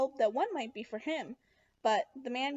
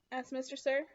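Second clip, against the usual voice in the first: usual speaking voice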